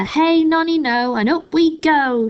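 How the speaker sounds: high, loud, slow sing-song crone yell; shout. A narrator's reading. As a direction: chant it out gleefully like a mad old woman. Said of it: "she shouted".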